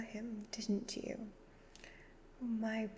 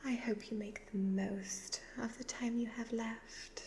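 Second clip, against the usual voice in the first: normal talking voice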